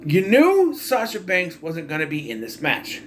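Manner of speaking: ordinary talk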